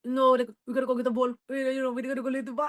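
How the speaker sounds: rapid, run-together mimicry of a teammate; mock rushed, thick-accented speech; imitating fast talk. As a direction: rattle it off as if barely understandable. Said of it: "And she talks so fast".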